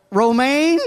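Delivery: high, loud, disbelieving question; can't believe what he's hearing; incredulous. Retold or imitated. Incredulous reply to waitress.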